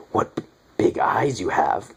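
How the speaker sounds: slow, breathy menacing whisper; creepy vampire whisper. Retold or imitated. Vampire pinning the girl to a tree, slow menacing whisper. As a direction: whisper it like a predator closing in.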